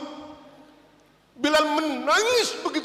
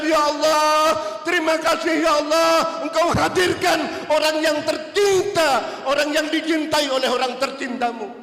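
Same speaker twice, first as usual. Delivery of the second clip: grateful outcry; 5 semitones higher, 5 dB louder than usual. Acted. Bilal embracing, thanking God.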